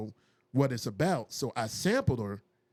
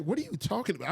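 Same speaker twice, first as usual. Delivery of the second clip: stunned disbelief; 8 semitones higher, faster than usual. Retold or imitated. Shocked by Emmy news.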